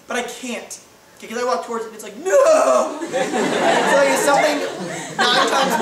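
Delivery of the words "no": high, loud squeal of the terrified bunny; panicked rabbit scream. Pretend. Pastor voicing the fleeing baby bunny, a high laughing shriek.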